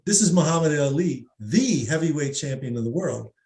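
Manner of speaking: "the" said as thee with a long ee. Shown as a demonstration. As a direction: say the word "the" as "thee", stressed. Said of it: "I've pronounced "the" in a different way".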